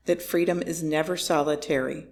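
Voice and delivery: ordinary delivery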